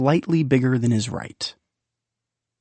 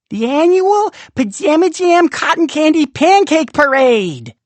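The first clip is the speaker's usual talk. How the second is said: much higher, louder parade herald; big announcement; 14 semitones higher, 5 dB louder than usual. A narrator's reading. Storyteller heralding the town's favorite parade, high and loud. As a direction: trumpet it like a carnival barker.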